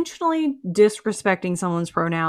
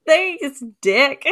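laughing sarcastic thanks; 7 semitones higher, 4 dB louder than usual. Retold or imitated.

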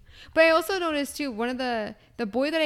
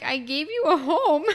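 mock exasperation; 4 semitones higher, 4 dB louder than usual. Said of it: "And it's just like".

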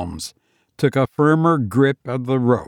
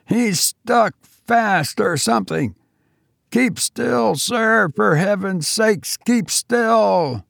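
high, urgent and straining; strained shouting; 9 semitones higher, slower than usual. Acted. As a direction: call down while hauling hard. Pulling a struggling weight, straining.

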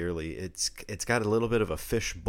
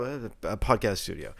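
ordinary talk

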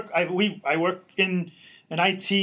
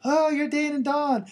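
lightly voiced eager fan, pitched higher; gushing fan; 6 semitones higher than usual. Host retelling a fan at the meet and greet, mock gushing. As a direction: play up the fan's breathless praise. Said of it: "pull me aside and say".

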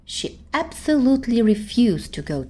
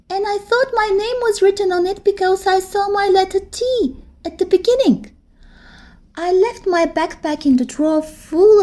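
put-on higher, pouting little-girl voice; a little girl envying her big friend; small girl voice; 8 semitones higher, 4 dB louder than usual. Teddy's voice.

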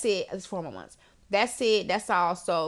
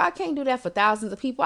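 ordinary talk

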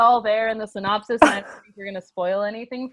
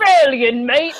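playful mock-Aussie call; Australian accent attempt; 5 dB louder than usual. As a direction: put on a bad Australian accent. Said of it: "I can't do Australian".

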